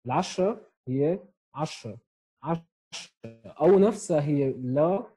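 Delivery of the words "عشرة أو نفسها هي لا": usual speaking voice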